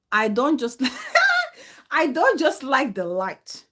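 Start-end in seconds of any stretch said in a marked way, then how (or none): 0.9-1.6 high sudden laugh; a real laugh escapes; burst of laughter. Real, not acted. Bursts out laughing mid-answer. 1.9-3.6 laughing. Real, not acted. Laughing through her own answer.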